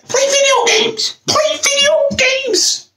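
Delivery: slow high falsetto mockery; mocking nerd voice. Mocking gamer nerds in a slow squeaky falsetto.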